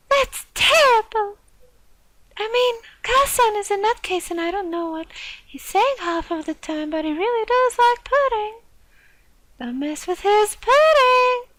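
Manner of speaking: put-on high, bright-pitched girly character voice; girl voice. Classmate defending Okasan's pudding in a high girl voice. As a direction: play the classmate in a high girl's voice. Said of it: "I'm using my girl voice for him".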